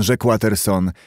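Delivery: ordinary delivery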